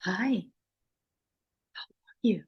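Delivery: put-on gentle, hushed greeting to a child; soft soothing voice. Shown as a demonstration. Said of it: "Talk like, you know".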